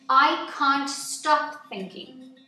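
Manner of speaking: ordinary delivery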